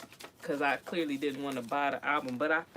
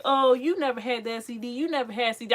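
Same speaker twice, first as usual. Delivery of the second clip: 1 mocking doubter; 6 semitones higher, 7 dB louder than usual. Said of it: "So if anybody be like".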